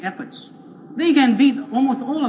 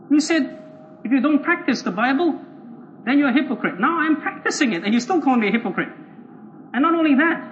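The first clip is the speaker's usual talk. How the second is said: higher-pitched, incredulous objection; affronted protest; 5 semitones higher than usual. Acted. Evangelical objects to being called a hypocrite, affronted protest. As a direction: plead and argue against the charge.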